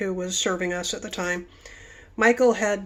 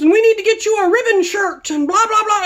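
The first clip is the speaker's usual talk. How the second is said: much higher, loud eager chief; imitating the chief; 11 semitones higher, 7 dB louder than usual. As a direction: pile on the welcome eagerly. Chief going on.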